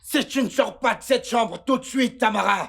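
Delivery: angry shout. Acted. Daniel yelling at Tamara to get out now. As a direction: blow up and yell the threat at her.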